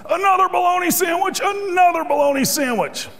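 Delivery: screaming. Retold or imitated. Worker losing it over his lunch, screaming. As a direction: yell Another bologna sandwich at full pitch. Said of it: "now he screams".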